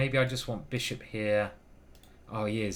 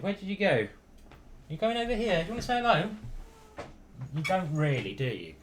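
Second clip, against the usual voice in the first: cooing pet talk; 5 semitones higher than usual. Real, not acted. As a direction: coax the cat to say hello. Talking to the cat.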